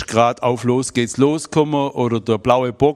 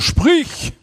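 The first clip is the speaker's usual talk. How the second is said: curt son; 5 dB louder than usual. Retold or imitated. Son prompting the father.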